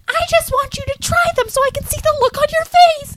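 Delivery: very high, squeaky granny voice; hammed-up high-pitched eagerness; excited old lady. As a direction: imitate a thrilled little granny. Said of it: "so excited about it".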